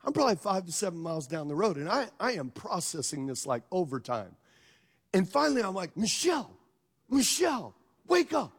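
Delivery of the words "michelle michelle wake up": calling to wake her. Retold or imitated. Waking sleeping wife in car.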